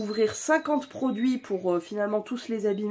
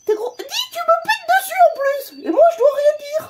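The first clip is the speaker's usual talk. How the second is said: very high, louder whining pet voice; whiny pet voice; 9 dB louder than usual. Pretend. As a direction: whine like the wronged pet. Pet voice complaining of farts.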